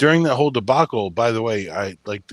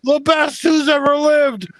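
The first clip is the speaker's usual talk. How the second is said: much higher, loud ring-announcer shout; wrestling intro; 6 dB louder than usual. Retold or imitated. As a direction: hype him up like a wrestling announcer. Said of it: "If I was cutting a freaking wrestling intro on you, I'd be like".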